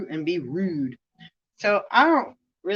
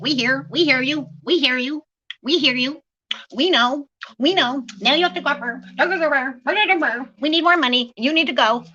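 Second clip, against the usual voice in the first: high, mock-impatient brush-off; a higher voice rushing someone out the door; mocking them; 6 semitones higher than usual. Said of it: "They're like".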